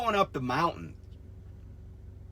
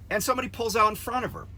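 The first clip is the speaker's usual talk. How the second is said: ordinary talk, nothing marked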